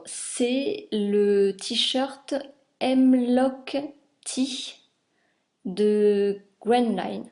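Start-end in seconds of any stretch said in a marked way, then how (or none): 2.8-7.2 attempted English accent, drawn out slowly; French speaker trying an English accent; bad English accent. Real, not acted. Vlogger names the T-shirt pattern, bad English accent. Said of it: "j'avais un super accent anglais".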